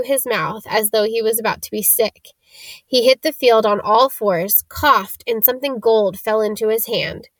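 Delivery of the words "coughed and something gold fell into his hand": ordinary delivery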